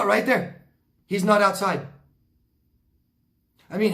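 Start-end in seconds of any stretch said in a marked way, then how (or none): 1.1-1.9 mock neighbor denial. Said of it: "She's like".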